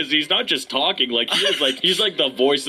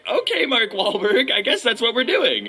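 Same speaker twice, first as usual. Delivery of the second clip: high-pitched mock-kid agreement; going along; 8 semitones higher than usual. Twelve-year-old going along with the star, high-pitched. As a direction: agree in a raised voice. Retold child's reply to star.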